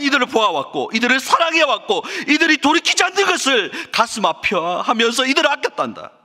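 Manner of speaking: high-pitched, impassioned pleading that builds; God's anguished plea. Acted. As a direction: plead as a God whose heart aches, swelling in intensity. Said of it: "하나님의 고통스러운 마음을 표현하는 거예요". (가슴 아파하며 격정적으로 호소)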